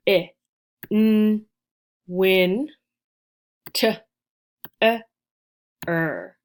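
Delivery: each sound punched, spaced out; "W, I, N, win, T, E, R" sounded out one by one. Shown as a demonstration. Teacher counts the sounds of winter with students, spaced out. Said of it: "We're going to count the sounds".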